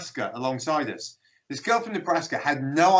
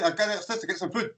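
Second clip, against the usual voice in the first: quick, higher Scottish run-on; rapid Scots speech; 3 semitones higher, 4 dB quieter, faster than usual. Retold or imitated. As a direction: repeat it fast in broad Glaswegian. Repeats friend's fast Scots phrase.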